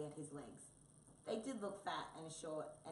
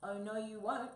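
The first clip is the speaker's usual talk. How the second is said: loud, raised, indignant cry; screeching; 5 semitones higher, 8 dB louder than usual. A narrator's reading. Tabby refuses to be flipped about, screeching. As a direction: screech your refusal in protest. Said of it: "screeched Tabby".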